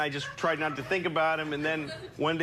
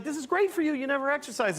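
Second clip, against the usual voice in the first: cheerful dog; 10 semitones higher than usual. Retold or imitated. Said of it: "she's like".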